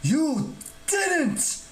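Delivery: very high-pitched indignant cry; scandalized god, pitch not volume; outraged shout. A narrator's reading. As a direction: cry out in affronted disbelief. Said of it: "shouted Terminius".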